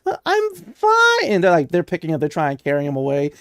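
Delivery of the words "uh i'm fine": high, drawn-out whine; protesting kid. Acted. Kid to parents carrying him off, high whiny protest. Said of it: "He's fanboying hard".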